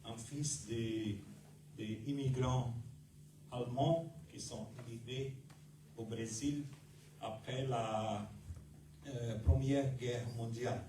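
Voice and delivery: normal talking voice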